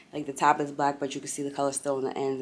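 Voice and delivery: ordinary talk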